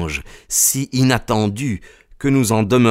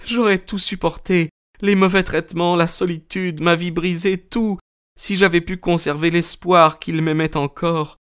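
high, anguished female character voice; acted lament of a wronged wife; tearful; 9 semitones higher than usual. A narrator's reading. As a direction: pour out grief in a high voice. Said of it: "d'une voix entrecoupée de sanglots".

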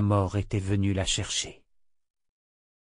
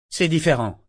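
higher, defensive protest; 5 semitones higher than usual. A narrator's reading. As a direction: defend yourself quickly. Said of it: "sur la défensive".

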